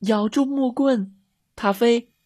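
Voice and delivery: put-on high, urgent child's call; shouting. A narrator's reading. Girls to the dog in the river, shouting. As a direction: shout an instruction, pitched high. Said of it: "米莉和茉莉喊道".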